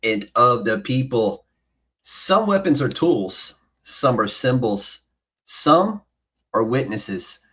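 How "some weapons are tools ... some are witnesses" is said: dramatic narration. Acted.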